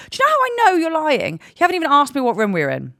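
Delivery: high, loud gotcha challenge; indignant retort. Retold or imitated. Guest catching the lodge worker lying about the blood, indignant. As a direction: catch them out in a lie, loudly.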